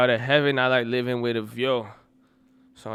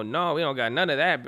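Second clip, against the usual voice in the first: normal talking voice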